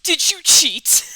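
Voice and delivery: shouted, drawn-out question; loud demand. Acted. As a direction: boom it out like an accusation. Game character demands an answer.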